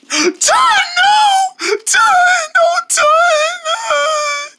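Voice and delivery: very high sobbing shouts of no; crying scream. Acted. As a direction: wail his name through tears. Friend dies, crying out.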